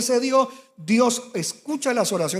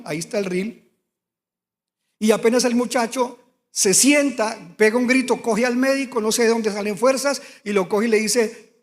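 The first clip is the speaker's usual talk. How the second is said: ordinary talk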